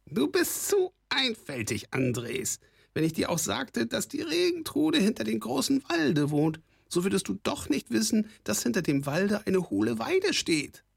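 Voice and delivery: very high goblin voice full of scorn; a scornful goblin's squawk; sneering kobold rasp. A narrator's reading. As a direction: talk down to him like a mocking goblin. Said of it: "schnarrte ihn an".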